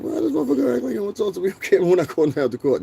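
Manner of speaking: high, fast, incredulous retelling; mock indignant self-quote. Telling the chat what he thought when Mark dodged the camera, high and fast. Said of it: "I was like".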